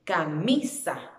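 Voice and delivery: slow Camisa, MI held long; stress on MI. Shown as a demonstration. Teacher answering where force falls, Camisa stressed on mi. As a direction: hit the middle mi of Camisa. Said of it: "En la sílaba mi".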